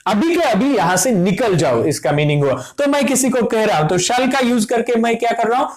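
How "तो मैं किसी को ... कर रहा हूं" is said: ordinary delivery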